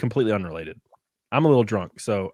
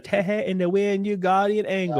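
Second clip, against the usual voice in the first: imitating Nell; 7 semitones higher than usual. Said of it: "she's like".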